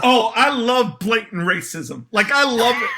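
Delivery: excited, laughing and slowed; gleeful laugh through the words. Real, not acted. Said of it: "you seem delighted about this".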